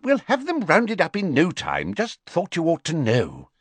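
high, fussy official voice; a busy minister brushing it off; Fudge's voice. Acted. Fudge tells the Prime Minister about Dumbledore in a high fussy voice.

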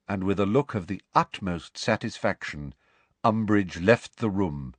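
ordinary delivery, nothing marked

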